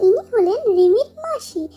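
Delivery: usual speaking voice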